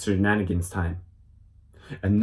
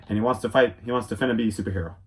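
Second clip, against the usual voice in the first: ordinary delivery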